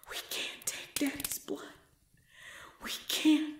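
whispered plea. Acted. Soothing the unborn baby.

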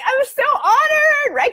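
high, loud, stretched-out squeal; thrilled gushing. Real, not acted. Author reacting to a reader's praise, thrilled gushing. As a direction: gush it slowly with a laugh, very high.

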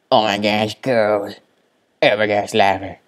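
excited. Real, not acted. Excited discovery.

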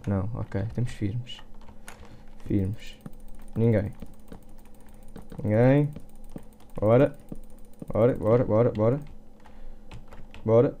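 ordinary delivery

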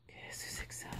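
barely audible whispered question; wondering under the breath; puzzled whisper. Real, not acted. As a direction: whisper it to yourself. Puzzled over a mystery parcel.

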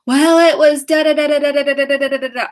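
put-on high, loud, fast child's babble; chattering child voice. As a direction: rattle it off like an excited kid. Child voiced answering parent.

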